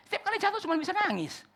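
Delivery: exasperated preacher, voice climbing; building exasperation. Shown as a demonstration. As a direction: push the repeat to a pleading peak. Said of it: "repeated, building".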